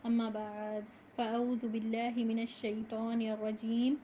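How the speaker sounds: slow, measured recitation of the opening prayers; formal Arabic recitation. Acted. (آہستہ، باوقار عربی تلاوت)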